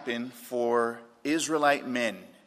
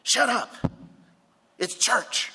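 put-on high, sharp scolding kid voice; little girl; 6 semitones higher, 4 dB louder than usual. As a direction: imitate a little girl telling off the boy beside her. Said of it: "punched him in the rib cage and said".

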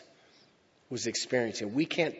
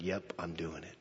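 soft resolve; 7 semitones lower, 13 dB quieter than usual. Retold or imitated. Jesus deciding in the garden.

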